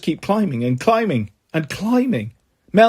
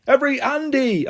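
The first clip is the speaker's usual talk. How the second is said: high, raised call to a crowd; yelled; 8 semitones higher than usual. A narrator's reading. Andy addressing the noisy crowd, yelling. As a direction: call for attention, pitched high without much volume. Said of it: "I yell".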